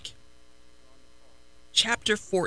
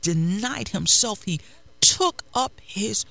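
steady, fervent sermon delivery; preaching; 4 dB louder than usual. Acted. Pastor preaching to the congregation, fervent. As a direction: drive the message home to the church.

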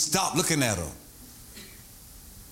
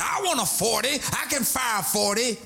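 higher-pitched mock lady recruit; playful impression of ladies boasting, voice raised; imitating the ladies; 7 semitones higher than usual. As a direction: imitate eager women officers, pitch up. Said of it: "That's what the ladies say".

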